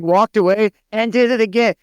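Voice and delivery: normal talking voice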